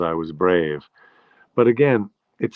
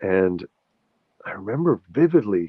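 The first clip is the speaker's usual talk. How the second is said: ordinary talk, nothing marked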